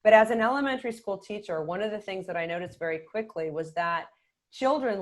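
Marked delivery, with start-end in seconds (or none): none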